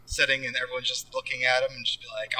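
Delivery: ordinary talk, nothing marked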